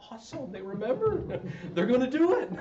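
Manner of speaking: pleasantly thrilled, voice lifting high; delighted reaction. Retold or imitated. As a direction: cheer them on with a chuckle. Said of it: "And I'm like".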